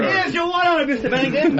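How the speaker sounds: put-on high, eager old-timey intern voice; overly polite, bright delivery; 1920s intern. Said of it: "talking like an intern from the twenties".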